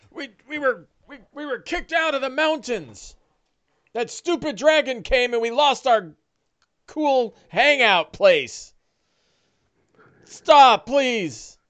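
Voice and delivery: high, panicky stammering orc; pleading orc voice. Acted. Captured orc begging Barundar for his life, high. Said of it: "He goes".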